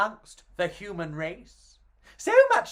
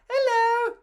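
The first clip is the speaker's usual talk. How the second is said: high, thin alien hello; bad alien voice; 3 dB louder than usual. Shown as a demonstration. Mama G voicing her alien friend badly, high. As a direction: try a squeaky alien greeting. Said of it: "That's not a very good alien voice".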